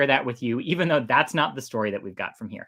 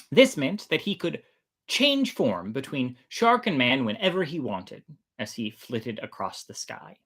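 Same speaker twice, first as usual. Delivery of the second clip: story narration. Acted. Host beginning the shark god chapter for listeners, storyteller voice. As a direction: read it like a myth told aloud.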